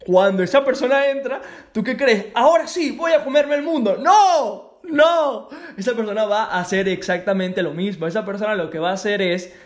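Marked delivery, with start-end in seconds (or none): 2.3-4.0 mocking a recruit who'll conquer the world; mock eager recruit. Mock quote of new recruit. 4.0-5.5 higher and louder firm refusal; loud, high double no; emphatic no. Real, not acted. Emphatic denial after mock quote.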